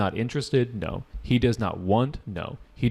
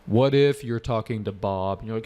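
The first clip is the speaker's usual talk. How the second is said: ordinary talk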